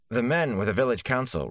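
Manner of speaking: earnest village lad explaining; Rand's voice. A narrator's reading. Rand explains the council to the Gleeman, earnest.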